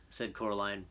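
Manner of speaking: plain reading voice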